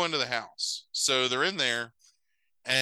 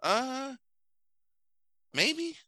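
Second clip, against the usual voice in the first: unsure; 14 semitones higher than usual. Real, not acted. Unsure answer to host's question.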